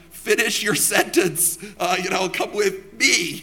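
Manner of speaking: loud, high-pitched, hammed-up correction; mock exasperation. Preacher mimics his retort to Midwesterners, mock exasperated. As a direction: playfully demand it with heavy, drawn-out emphasis.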